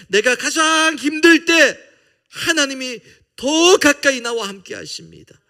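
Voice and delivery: fervent preaching climax; impassioned proclamation. Acted. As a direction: lift it to the rafters at the sermon's peak.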